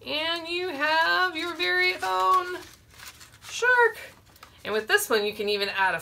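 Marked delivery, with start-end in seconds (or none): 0.1-4.0 excited reveal. Real, not acted. Reveals the finished puppet.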